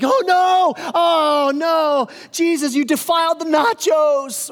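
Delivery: high panicked shout, slowing at the end; a scandalised Pharisee yelling in horror; freaking out. Retold or imitated. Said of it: "this guy is freaking out".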